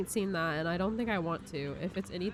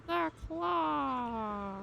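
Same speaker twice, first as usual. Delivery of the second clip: awed squeaky alien cry; toy alien voice; 6 semitones higher than usual. Retold or imitated. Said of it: "Look at Spider Bing Bong and go".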